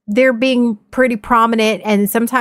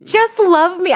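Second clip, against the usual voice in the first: high, exasperated mock plea; re-enacted outburst begging for support; pleading yell; 12 semitones higher, 4 dB louder, slower than usual. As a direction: cry it out as a frustrated demand. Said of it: "I literally fucking yelled at him".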